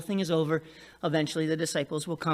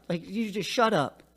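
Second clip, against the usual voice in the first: ordinary talk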